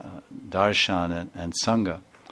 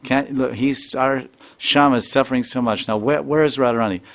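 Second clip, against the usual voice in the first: high, loud pleading rebuke; urgent scolding; 4 semitones higher, 6 dB louder than usual. Retold or imitated. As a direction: cut her off and defend him. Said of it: "they said".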